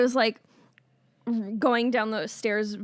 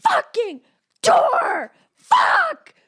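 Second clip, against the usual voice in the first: high, loud yell at the door; mock rage yelled at a car door; furious yell; 7 dB louder, slower than usual. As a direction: scream at the door like it wronged you. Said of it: "And I'm like".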